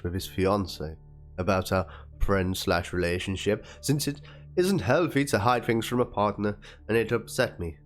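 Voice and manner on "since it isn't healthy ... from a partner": usual speaking voice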